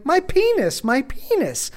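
high, gleeful mock kid yell; imitated child's shouting. Son in the dressing room for all to hear, imitated shouting. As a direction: sing out high like a naughty child. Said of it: "now he's shouting this".

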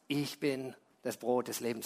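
hushed solemn proclamation; solemn low quote. Acted. Jesus quoted solemnly again.

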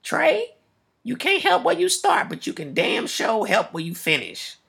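high-pitched grandmother voice, slowing at the end. Retold or imitated. Host quoting his grandmother's advice, high-pitched grandmother voice. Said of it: "something that my grandmother used to tell me".

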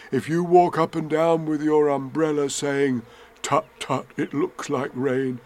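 lightly voiced bear, planning; a hint of an earnest little bear; Winnie the Pooh. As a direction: lay out the scheme as a storybook bear.

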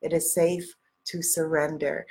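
usual speaking voice

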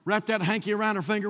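ordinary delivery

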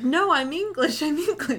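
loud, high, hammed-up English accent; over-the-top Englishman protesting his Englishness; mock English accent. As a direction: insist in an exaggerated English accent, laughing into it. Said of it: "trying to be an Englishman".

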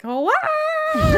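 very high, loud imitated scream; hammed-up soprano shriek; mock operatic scream. Senta leaping off the cliff, mock scream. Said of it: "has this scream in the score".

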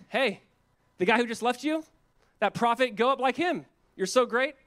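mocking. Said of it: "Mocking Elijah".

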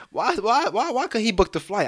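incredulous. Real, not acted. Incredulous at the story.

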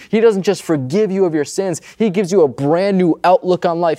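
ordinary talk

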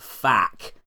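"fack" with an open "a" as in "back"; "fack", Cockney vowel set apart; Cockney spelling pronunciation. Shown as a demonstration. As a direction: say "fack" as a mock-Cockney swear. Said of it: "for Cockneys as well".

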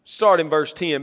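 ordinary delivery

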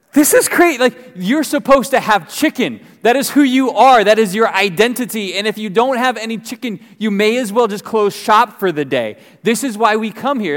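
high-pitched, sarcastic comic outrage; exasperated rant. Retold or imitated. Customer scolding a chicken shop without chicken, exasperated. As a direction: rant in mock outrage at the absurdity.

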